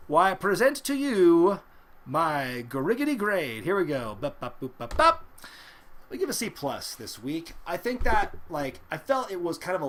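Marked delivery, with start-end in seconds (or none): 4.2-5.2 mock fanfare. Drumroll before the grade.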